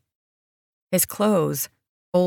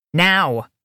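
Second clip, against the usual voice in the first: commanding; 3 semitones higher, 5 dB louder than usual. A narrator's reading. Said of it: "she commanded".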